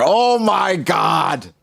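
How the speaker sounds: real disbelief, dragged out; drawn-out shock. Real, not acted. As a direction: let the shock stretch out. Shocked reaction to Rayleigh news.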